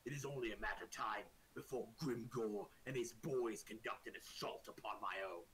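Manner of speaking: a low-voiced orc ruler, deliberate and grave; orc king. Orc king warning an adventurer of a coming assault, grave.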